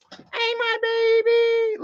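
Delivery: stretched-out sing-song doggy talk. Real, not acted. Cooing at his dog Penny.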